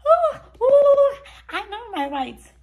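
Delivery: high, loud shouted protest of rights; defiant shout. Retold or imitated. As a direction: yell it at the officers, defiant. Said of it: "because I was saying".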